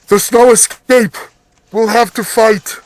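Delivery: urgent man's voice. Acted. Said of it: "you hear the voice of a man saying".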